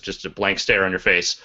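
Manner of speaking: normal talking voice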